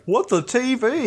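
high, incredulous put-on voice; mock baffled raider. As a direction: squawk the raider's disbelief as a joke.

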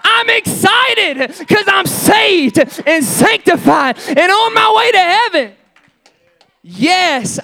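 high, shouted revival preaching; fervent pulpit shouting with hard-hit phrases; full-force preaching. Real, not acted. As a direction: preach it out in loud, driving bursts.

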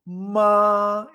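"Ma" high, loud and held level; first tone. Shown as a demonstration. Teacher asks learner to repeat, "Ma" sustained high and level. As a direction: hold "Ma" steady on a high pitch. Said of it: "Y continuo".

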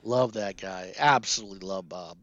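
usual speaking voice

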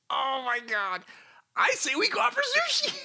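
put-on high, bright girly voice; squeaky young woman quoting a line; Mary Jane voice. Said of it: "Mary Jane's response".

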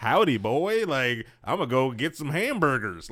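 high, loud mock Texan; Texan accent. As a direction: ham up a Texan drawl. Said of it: "or were Texans".

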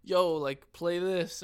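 loud, casual call-out; loud demand. Retold or imitated. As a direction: bark it like a rowdy stranger. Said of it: "and then they say".

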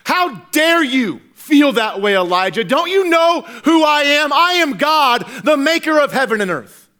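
high, thundering mock rebuke; God yelling. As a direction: bellow it with outraged authority. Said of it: "to yell at Elijah".

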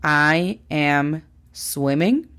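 "I am swimming" drawn out for learners; slow, clear teacher model; slow model sentence. Shown as a demonstration. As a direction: say "I am swimming" very slowly. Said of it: "¿es correcta la frase".

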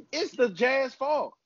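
real assertive insistence; aggressive. Real, not acted. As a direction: land the blame with a sudden pitch jump. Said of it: "you got aggressive with that".

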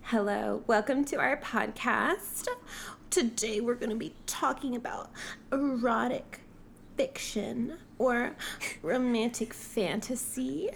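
soft mock-seductive starlet, endings lifted; hammed-up sultry podcast host; porn star voice.

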